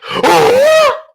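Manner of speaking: shrill high gasp; loud theatrical gasp; dramatic gasp. Acted. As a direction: gasp in mock horror. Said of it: "Oh, not the, not the wolf".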